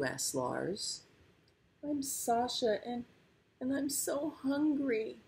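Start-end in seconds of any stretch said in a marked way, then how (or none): 1.8-5.2 small high whimper; high-pitched, pitiful introduction; tearful little tiger. A narrator's reading. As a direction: sound like a hungry, tearful cub. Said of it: "started to cry".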